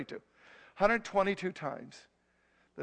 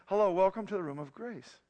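ordinary talk

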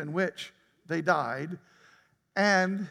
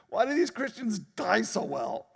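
laughing; 4 semitones higher than usual. Real, not acted. Laughing rhetorical question.

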